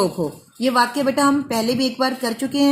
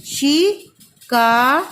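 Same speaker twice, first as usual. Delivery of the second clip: drawn-out sounding; much slower than usual. Shown as a demonstration. Teacher sounding out शिकार slowly for pupils. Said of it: "इसको बोल बोल कर लिखिए".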